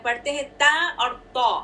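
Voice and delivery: ordinary talk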